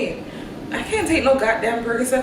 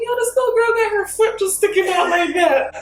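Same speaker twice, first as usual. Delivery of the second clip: higher, louder amused inner voice; replaying her own surprised thought, pitched up; amused recollection; mock inner thought; 12 semitones higher, 4 dB louder than usual. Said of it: "So I'm thinking".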